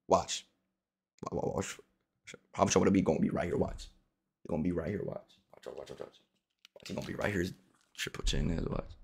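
soft, low hushed watch; creeping quiet murmur. Real, not acted. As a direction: whisper so the target can't hear. Sneaking up in game.

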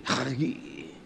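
very soft, low, eerie utterance; spooky. Shown as a demonstration. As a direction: let the word creep out in a hush. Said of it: "It sounds kinda spooky".

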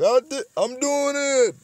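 high, loud mock triumph; hammed-up victory shout; triumphant boxer. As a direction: crow it like a winning boxer.

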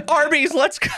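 high, hyped-up mock cheer; mock excited. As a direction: pump it up like a joke. Mock fate commanding fries.

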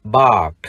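"bark" with long ah and final k; model pronunciation. Shown as a demonstration. Teacher shows how to read bark, "bark" as long ah plus k. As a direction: say "bark" without a heard r, releasing the k. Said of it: "chúng ta đọc là".

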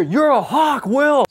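high, loud mock director voice; hammed-up director correcting an extra; director insisting. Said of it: "And the director".

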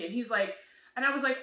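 normal talking voice

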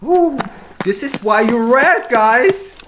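high, loud elated; excited cheer. Real, not acted. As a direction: cheer at the viewers. Cheering after success.

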